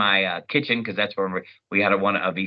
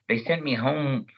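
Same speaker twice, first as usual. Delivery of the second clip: ordinary talk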